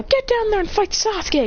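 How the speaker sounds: high fangirl voice; yelling fangirl. Acted. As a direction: egg them on in a high fan voice. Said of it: "all the fangirls yell".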